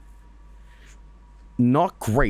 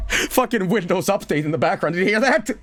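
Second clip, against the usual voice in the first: startled; 8 semitones higher than usual. Real, not acted. Scared by computer noise.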